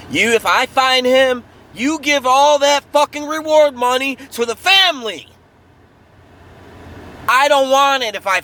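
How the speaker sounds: angry outburst. Real, not acted. Furious rant about finding the killer.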